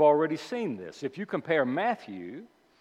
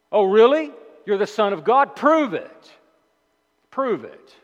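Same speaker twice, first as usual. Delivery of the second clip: high, loud sneering taunt; mocking taunt; 5 semitones higher, 7 dB louder than usual. Devil taunting Jesus to prove himself, loud sneering mockery. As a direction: sneer it, daring him to prove it. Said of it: "sarcastic, mocking, accusatory question".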